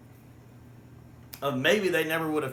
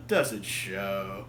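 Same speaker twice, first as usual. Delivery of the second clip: mock proud quip; 5 semitones lower, much slower than usual. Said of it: "the way Troughton does that".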